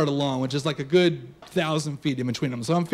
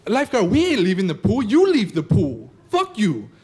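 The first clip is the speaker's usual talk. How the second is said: put-on defiant kid; 3 semitones higher, 4 dB louder than usual. Kid refusing the lifeguard's order to leave the pool, defiant. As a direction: push back hard, stretching the comeback.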